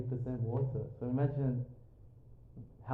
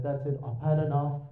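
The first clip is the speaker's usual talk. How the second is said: put-on a rough go at a South African accent; attempted South African accent; 3 semitones higher, 4 dB louder than usual. Imitating South African relative leaving for Australia, attempted South African accent. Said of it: "Is that your South African accent?".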